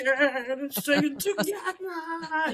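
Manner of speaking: calling out to crowd. Retold or imitated. Rallying crowd at her concert.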